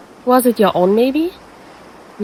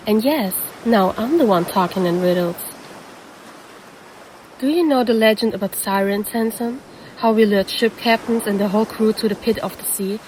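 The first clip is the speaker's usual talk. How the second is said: ordinary delivery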